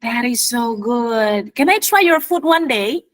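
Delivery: ordinary delivery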